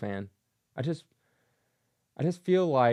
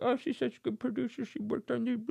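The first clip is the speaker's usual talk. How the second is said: mock defender; 10 semitones higher, 5 dB quieter than usual. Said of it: "people are like".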